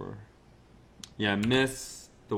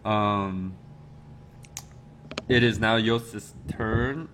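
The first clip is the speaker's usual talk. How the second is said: normal talking voice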